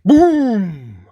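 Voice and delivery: loud, high, drawn-out boom; booming crash. A narrator's reading. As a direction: sound out the bang with full voice. Sudden thunderous noise in the story.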